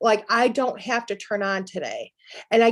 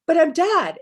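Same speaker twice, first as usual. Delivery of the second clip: startled realization; 5 semitones higher, 5 dB louder than usual. Retold or imitated. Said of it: "She's like".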